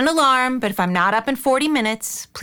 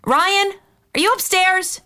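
higher-pitched call up the stairs; 7 semitones higher than usual. Acted. Woman checks whether Ryan is upstairs, calling out.

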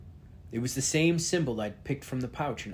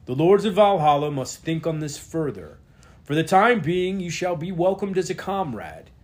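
booming lord; 4 semitones higher, 8 dB louder than usual. Acted. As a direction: proclaim like a stern king. Reads the lord's speech.

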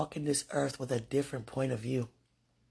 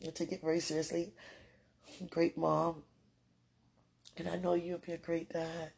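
normal talking voice

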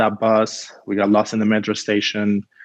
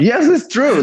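insisting; 5 dB louder, slower than usual. Real, not acted. Teasing friend, insisting.

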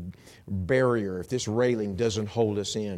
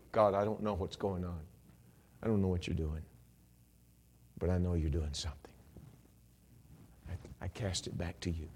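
low, soft surrender; hushed prayer; 3 semitones lower, 5 dB quieter than usual. Acted. Preacher to God, casting a care back, hushed. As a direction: pray it quietly, pitched down.

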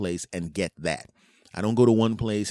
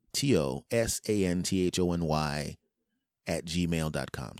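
ordinary talk, nothing marked

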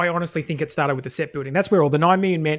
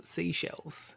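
whispered warm-up; 13 dB quieter than usual. Shown as a demonstration. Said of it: "I'm just gargling".

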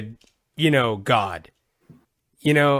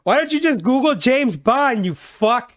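high, loud mock-exasperated computer voice; a hammed-up annoyed Google scolding the user; Google yelling; 10 semitones higher, 4 dB louder than usual. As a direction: play the search engine fed up with you. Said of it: "This is Google yelling at me".

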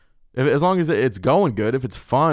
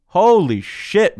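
usual speaking voice

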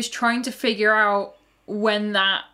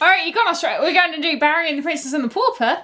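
higher-pitched, badly faked Australian accent; 6 semitones higher, faster than usual. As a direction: put on a hammy, poor Australian accent. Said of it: "That's a bad accent".

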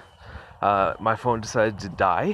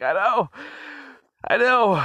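whiny I know; 13 semitones higher than usual. Retold or imitated. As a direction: moan it back at your teasing wife. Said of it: "And I'm like".

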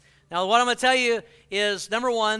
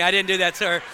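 normal talking voice

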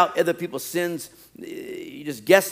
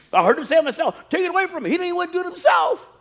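scandalised gossip; 12 semitones higher, faster than usual. Acted. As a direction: shout it like juicy scandal. Mocking gossip about Jesus's words.

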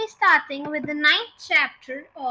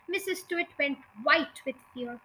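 ordinary delivery, nothing marked

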